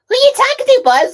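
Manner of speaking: very high, loud squawky challenge; acted interrupting character. Crewmate catches the space ranger talking, high loud voice. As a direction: cut in with a shrieked high-pitched question.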